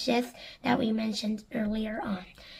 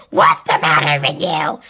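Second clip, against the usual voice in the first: low, loud snarl; harsh aunt Spiker; 8 semitones lower, 9 dB louder than usual. A narrator's reading. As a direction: bark at the boy. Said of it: "asked Spiker Screech".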